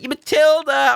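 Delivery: high, loud call to the dog; loud greeting. Retold or imitated. Greeting the excited dog loudly.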